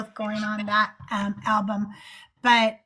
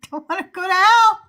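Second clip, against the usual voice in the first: higher, louder, fast mock panic; mock fear of hell; 7 semitones higher, 6 dB louder, faster than usual. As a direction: parody a scared churchgoer, rushed. Mocking church fear of hell.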